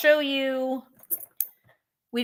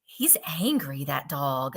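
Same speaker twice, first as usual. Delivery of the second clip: mock whisper; 7 semitones lower, 6 dB quieter than usual. Joking about angry dog picture.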